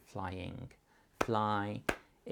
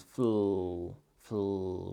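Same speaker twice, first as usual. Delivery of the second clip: "fl" sounded out crisply, first louder; clear blend; 4 dB louder than usual. Shown as a demonstration. Parent sounding "fl" out for a child who can't hear it. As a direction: say "fl" slowly and clearly. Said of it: "you could just say the two".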